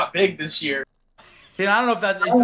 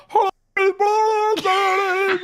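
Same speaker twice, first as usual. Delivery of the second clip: gurgling as if drowning; underwater; 12 semitones higher, much slower than usual. Acted. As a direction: sing it like you're going under water. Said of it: "He sounds like he's drowning".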